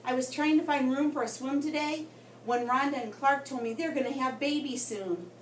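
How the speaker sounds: usual speaking voice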